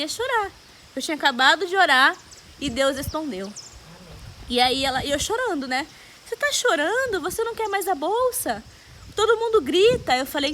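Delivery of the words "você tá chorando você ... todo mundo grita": high, astonished mock questioning; raised pitch, lively retold disbelief; incredulous caller. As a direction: imitate a caller baffled by the tears. Acted caller reacting to her crying.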